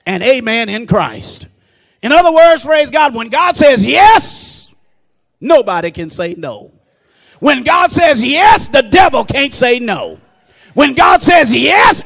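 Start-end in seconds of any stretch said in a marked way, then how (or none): 2.0-6.7 raised, ringing proclamation with a long "yes"; rousing pulpit cry; full-force preaching. Real, not acted. 7.4-12.0 raised, ringing refrain with a stretched "yes"; triumphant pulpit declaration; full-force preaching. Real, not acted.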